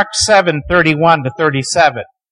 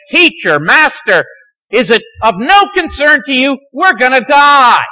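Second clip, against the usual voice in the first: high, louder frightened plea of the sailors; panicked cry; 8 semitones higher, 4 dB louder than usual. Retold or imitated. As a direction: cry out as if the boat is sinking. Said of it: "professional seamen are terrified".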